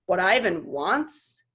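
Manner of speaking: slow, doubtful repeat; slow skeptical echo; slowly. Acted. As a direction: drag the words out in disbelief. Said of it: "he repeats slowly".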